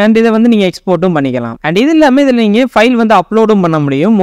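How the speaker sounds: ordinary talk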